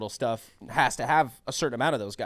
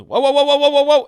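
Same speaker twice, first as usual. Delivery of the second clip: loud, high panicked whoas; alarmed whoa; 13 semitones higher, 15 dB louder than usual. Retold or imitated. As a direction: stop him from falling. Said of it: "he goes".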